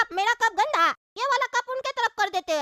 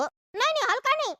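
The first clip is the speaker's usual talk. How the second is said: ordinary delivery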